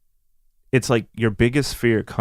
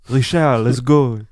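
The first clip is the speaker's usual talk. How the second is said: put-on dad's thick French accent, a bit louder; 4 dB louder than usual. Dad rounding up the family to drive home, French accent. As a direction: imitate a French father's English. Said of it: "The accent, bro".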